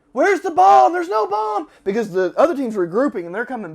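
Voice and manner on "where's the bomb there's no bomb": high, loud panicked shout; frantic yelling. Retold or imitated. Team yelling for the bomb inside the embassy, frantic shout. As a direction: yell it like you're searching in a hurry. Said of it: "We're like".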